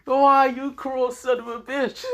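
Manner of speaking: high, slow, drawn-out lament; mock crying wail. Hero crying over his missing weapon, theatrical wail. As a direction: wail it out like a hammed-up sob. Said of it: "He's crying. He's like".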